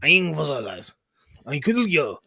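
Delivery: clumsy mock German; German accent. Shown as a demonstration. Speaker improvises as a German, clumsy German accent. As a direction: fumble through fake German. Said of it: "What is a German doing?".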